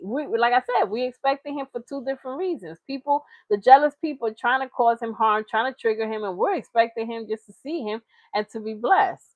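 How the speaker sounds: ordinary talk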